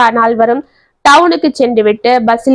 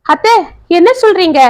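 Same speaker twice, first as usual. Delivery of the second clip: higher, alarmed question; anxious; 6 semitones higher than usual. A narrator's reading. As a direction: ask it in sudden alarm, voice pitched up. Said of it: "என்றான் பார்த்திபன் பதட்டமாக".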